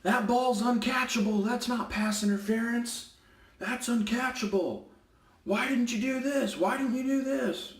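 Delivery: high, nagging coach impression; a coach pestering the ref, hammed up high; mock whiny coach. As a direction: whine it like an outraged coach. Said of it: "he comes up to me and he says".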